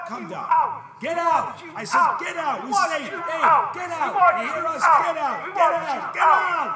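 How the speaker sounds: very high, very loud yells; furious shouting. Acted. Crowd to the devil, furious shouting. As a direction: scream him out of the building.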